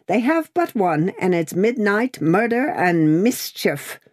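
high, dramatic denunciation; Miss Pross. A narrator's reading. Miss Pross denounces the redheads.

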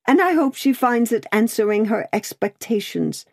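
distracted cry. A narrator's reading. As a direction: say it in mild distraction. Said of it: "cried the distracted Miss Pross".

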